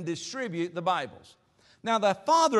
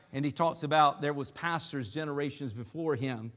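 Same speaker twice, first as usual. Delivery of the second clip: ordinary talk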